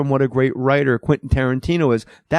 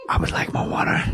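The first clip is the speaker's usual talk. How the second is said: laughing whispered Walken; whispered Walken request; 3 dB quieter than usual. Acted. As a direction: whisper it with a giggle. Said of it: "the whisper".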